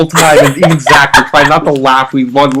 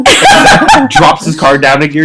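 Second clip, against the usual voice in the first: usual speaking voice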